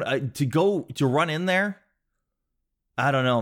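ordinary talk, nothing marked

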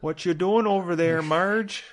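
put-on high Minnesota accent from Fargo. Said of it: "That is Fargo".

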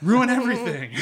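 high, loud frustrated groan, slowing on stupid vampires; exasperated outburst. Real, not acted. Fan reacting to tragic ending, exasperated outburst. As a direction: vent at the ruined romance.

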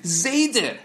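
put-on child's sudden call, pitched high; lightly acted boy calling out; sudden cry. A narrator's reading. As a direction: cry out the name in a high, urgent burst. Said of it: "he let out a cry".

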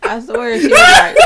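loud, high laughing shout through tears; laughing hard. Real, not acted. Telling friends about the bus kids, shrieking with laughter. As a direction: crack up so hard the words squeal out. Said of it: "Andrina got me in tears".

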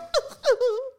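high, loud burst of laughter; sudden high laugh at the story. Real, not acted. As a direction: laugh out loud, caught off guard. Laughs at walking out.

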